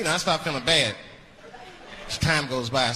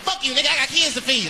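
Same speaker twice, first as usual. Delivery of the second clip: put-on baby's comeback; 8 semitones higher, 3 dB louder than usual.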